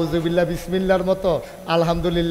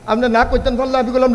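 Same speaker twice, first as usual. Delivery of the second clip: ordinary talk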